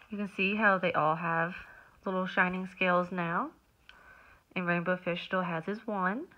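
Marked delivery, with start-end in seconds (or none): none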